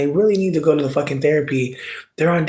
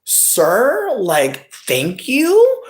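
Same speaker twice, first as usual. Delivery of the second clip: mock polite thanks; 10 semitones higher, much slower than usual. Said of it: "And I'm just like".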